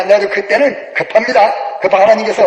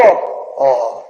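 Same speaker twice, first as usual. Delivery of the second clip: normal talking voice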